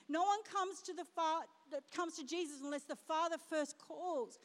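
normal talking voice

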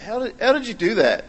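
baffled dad confronting the mess; incredulous parent. Retold or imitated. Exasperated at child's toothpaste mess.

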